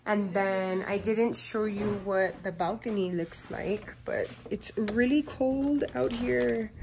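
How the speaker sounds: loud, bright, excited tour; bubbly and thrilled, showing off. Real, not acted. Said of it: "I was a little excited".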